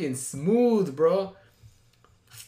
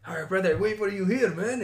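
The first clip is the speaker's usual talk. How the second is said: higher put-on Armenian accent; imitated Armenian accent; 4 semitones higher than usual. Date seeing driver off to the car, imitated Armenian accent. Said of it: "the accent goes from Armenian to fucking Spanish".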